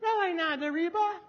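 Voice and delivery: put-on high, childlike quoted voice; imitated child. Child telling a friend about the mountaintop, imitated high. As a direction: pitch it way up like a kid. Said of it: "out of the mouths of babes".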